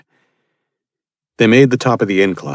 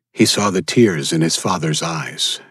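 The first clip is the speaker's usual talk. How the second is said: ordinary talk, nothing marked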